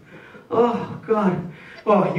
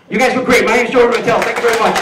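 loud sign-off; 4 semitones higher, 7 dB louder, faster than usual. Real, not acted. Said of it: "sign-off to crowd".

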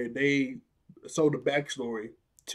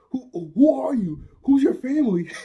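frantic yelling; 11 semitones higher, 9 dB louder than usual. Retold or imitated. Jeremy yelling at the new girl, high-pitched shouting. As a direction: shriek it like a panicked kid. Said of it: "he goes".

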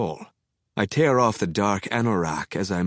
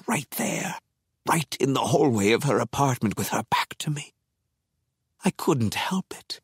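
breathy hushed narration; whispering; 4 semitones higher than usual. A narrator's reading. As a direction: whisper it like a stalker. Villain narrator stalking, whispered.

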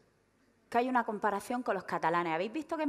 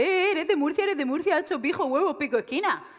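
raised, louder mocking chant; pestering outsiders; 5 semitones higher, 4 dB louder than usual. People outside the region tease a Murcian, mocking raised voice. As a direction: imitate people teasing someone about their origins. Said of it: "y ya enseguida la gente".

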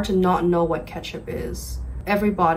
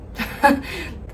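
short laugh; 7 semitones higher than usual. Real, not acted. Amused at her own joke.

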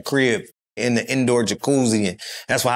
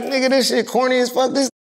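mocking Atlanta locals; 10 semitones higher than usual. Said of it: "they like".

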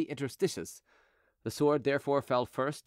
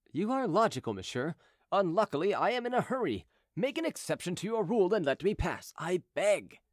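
higher-pitched gentleman asking a favour; Chicot, politely pleading; 5 semitones higher than usual. Acted. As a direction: concede politely, then plead to pass. Chicot figure in story narration.

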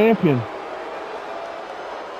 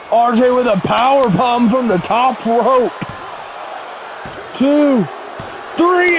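live ringside play-by-play; wrestling commentator; 3 semitones higher than usual. Acted. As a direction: call the finish like a commentator. Commentates the title finish.

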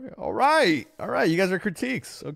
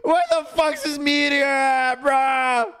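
frustrated yell; 8 semitones higher, 6 dB louder, slower than usual. Real, not acted. Frustrated yelling at game.

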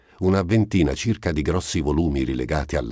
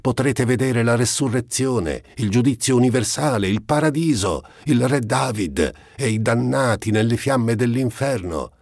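shouting after them; 8 semitones higher than usual. A narrator's reading. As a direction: keep yelling the sights after them. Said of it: "gridò loro dietro lo scaccino".

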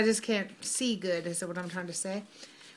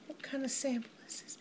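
whisper; 3 semitones higher, 10 dB quieter, faster than usual. Real, not acted. Reads a sample label to herself.

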